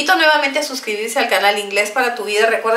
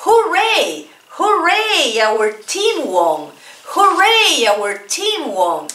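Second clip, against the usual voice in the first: high bright cheer; excited cheer; cheering hooray; 5 semitones higher, much slower than usual. Shown as a demonstration. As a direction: celebrate like a fan. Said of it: "Let's repeat".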